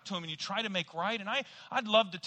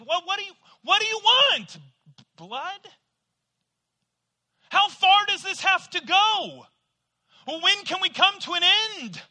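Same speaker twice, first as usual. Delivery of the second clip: high, loud exasperated pleading; exasperated outburst; 11 semitones higher, 8 dB louder than usual. Retold or imitated. Apologizer pleading loudly with the unforgiving person, high and exasperated. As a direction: throw up your hands, loud and high.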